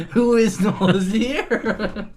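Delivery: high, slow giggling query; laughing question. Real, not acted. Player baffled by a town name, laughing question. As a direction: ask through laughter.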